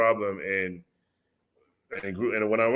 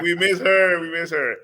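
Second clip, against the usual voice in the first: pitched-up mock plea; imitating wife pleading; 6 semitones higher, 4 dB louder than usual. Retelling a wife begging him to bring her back, voiced higher. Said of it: "she, like".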